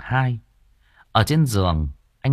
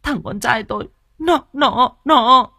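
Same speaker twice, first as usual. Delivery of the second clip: high, loud acted wail, stammering on the last word; tearful wailing; 6 dB louder than usual. A narrator's reading. Mother telling about her son, tearfully wailing. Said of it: "Bà Nụ mếu máo".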